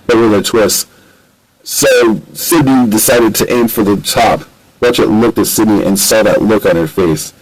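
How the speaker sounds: plain reading voice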